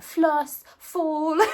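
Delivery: high, loud hammed-up American twang on floss and fall; mock American accent. British stitcher mocking American flosstubers in an American accent. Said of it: "That's, that's the worst American accent".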